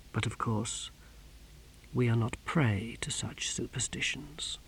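very soft, near-whispered warning; quiet menace. A narrator's reading. Exquisitor warning the captain about superstition, menacingly quiet. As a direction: drop to a chilling hush.

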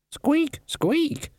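two very high rat squeaks; high-pitched rodent voice; Death of Rats squeaking. A narrator's reading. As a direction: squeak twice like a tiny rodent. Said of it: "The Death of Rats squeaked".

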